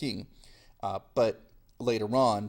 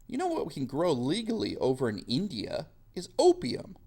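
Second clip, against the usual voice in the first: mock scheming colonial voice, pitched higher; scheming British; 10 semitones higher than usual. Teacher voicing British plotting opium smuggling, hammed-up high mock voice. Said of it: "so the British say".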